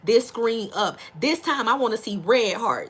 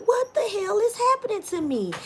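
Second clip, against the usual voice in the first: mock shock; 8 semitones higher than usual. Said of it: "in the mirror like".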